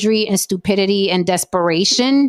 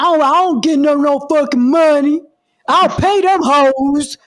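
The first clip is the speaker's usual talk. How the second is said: hammed-up male blowhard, higher and louder; mock man voice; 6 semitones higher, 4 dB louder than usual. Woman mimicking the men's retort about paying, hammed-up man voice. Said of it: "that's my man voice".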